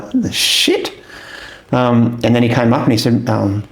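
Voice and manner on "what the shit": shocked, high whisper; stunned disbelief. Retold or imitated. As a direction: whisper it, unable to believe it. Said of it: "we were like".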